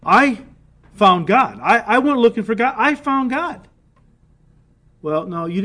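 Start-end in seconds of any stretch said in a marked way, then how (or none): 0.0-3.7 indignant protest. Retold or imitated. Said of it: "some would say".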